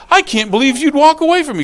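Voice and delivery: high, loud, fast acted protest; outraged mock yell; yelling husband. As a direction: shout it after someone walking away. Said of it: "he's yelling and screaming".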